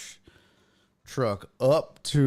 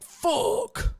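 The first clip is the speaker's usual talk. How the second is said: panicked fuck. Retold or imitated. Realizing trash truck passed.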